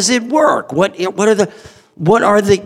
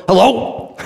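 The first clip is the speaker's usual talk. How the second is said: mock phone hello, pitched up; horrible phone greeting; 5 semitones higher than usual. Boss answering the phone, hammed-up bad greeting. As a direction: answer the phone badly, voice jumping higher. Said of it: "I'm horrible on the phone".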